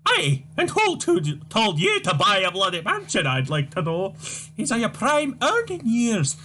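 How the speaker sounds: put-on high, wailing, scolding old mother voice; shrill old Scottish mum. Mother wails at son Tavish, high despairing scold. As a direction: play the despairing mother crying to the Lord.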